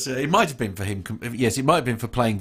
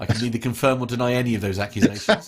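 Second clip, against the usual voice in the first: normal talking voice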